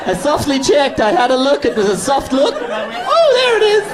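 hammed-up gasp of discovery; mock surprised peek. Lender peeking at credit score, mock squeaky surprise. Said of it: "and then we just go".

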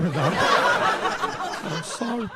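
usual speaking voice

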